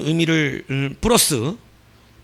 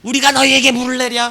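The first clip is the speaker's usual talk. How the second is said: higher, louder angry shout; angry Moses outburst; 9 semitones higher, 7 dB louder than usual. Acted. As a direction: snap at the people in exasperation. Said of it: "이스라엘 백성에게 화가 났던 거예요".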